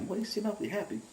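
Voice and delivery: high, soft imitation of a mother; imitating mom. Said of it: "That's what my mom had said".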